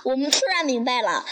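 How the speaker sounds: plain reading voice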